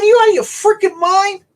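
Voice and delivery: loud retold disbelief; incredulous. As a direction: call them crazy. Retold disbelief at catfish hunters.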